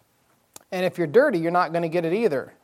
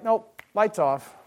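God's curt dismissal; 2 semitones higher, slower than usual. Retold or imitated. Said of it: "So God's like".

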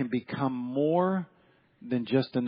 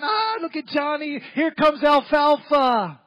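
put-on high, teasing kids jeering; sing-song taunt, higher, slowing at the end; mocking kids; 12 semitones higher, 7 dB louder, slower than usual. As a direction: jeer at him like a schoolyard bully. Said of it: "they're laughing".

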